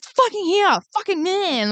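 high, exasperated retort; mock angry snap. Friend retorting to friend about the ring light, hammed-up snap. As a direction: snap back in mock annoyance. Retelling snapping back at friend.

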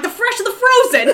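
high, loud, laughing mock New Yorker; New York accent. Answering boyfriend about the salmon, mock New York accent. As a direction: fire back as an exasperated comic New Yorker. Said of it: "I'm fucking from New York".

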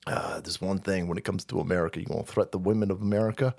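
put-on low, soft mumbling old man; lightly voiced mumbling Biden; Biden impression. Mocking the president's threat, low mumble. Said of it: "he said".

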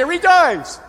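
loud, high hammed-up proclamation; mock rejoicing. Preacher mocks a grinning lady's loud rejoicing, hammed-up shout. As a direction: belt out Rejoice like a mock revival cry. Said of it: "She'd come in and say".

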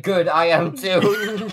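slow, drawn-out mock line; mock tender. Yamato answering Taiji, slow mock tender. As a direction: stretch it out, half teasing. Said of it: "it's like".

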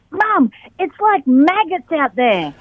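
put-on high, slow child's voice; wide-eyed kid, drawn out; little girl voice. Child quoted by her mother.

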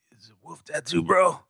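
whispered dudebro meme voice; breathy whisper, then a rising "bro". Acted. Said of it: "all the memes about the".

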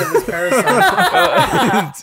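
slow laughing high answer. Real, not acted. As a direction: laugh it out slowly.

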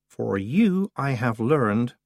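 slow courtly greeting; bow with the words, slowly; Wintersmith. A narrator's reading. The Wintersmith greeting Tiffany, slow and courtly.

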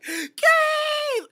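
high, loud imitated shriek of a name; mock hysterical scream. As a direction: shriek the name like the panicking heroine. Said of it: "She's like".

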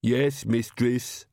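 very slow, thick-tongued servant; Igor. A narrator's reading. Coachman obeying his mistress, servile henchman voice. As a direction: answer as a plodding, obedient minion.

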